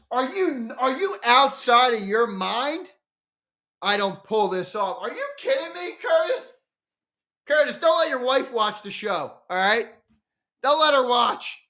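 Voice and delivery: high-pitched mock disbelief at a viewer; incredulous teasing. Real, not acted. Streamer teasing viewer Curtis over his bad take, high incredulous voice. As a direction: tease him like he's lost his mind.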